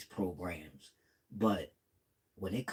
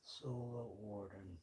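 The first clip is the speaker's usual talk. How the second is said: very soft, slow self-reminder; hushed and drawn out, to oneself; murmuring to oneself; 14 dB quieter, slower than usual. Real, not acted. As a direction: murmur it slowly while jotting it down. Repeats name while writing it down.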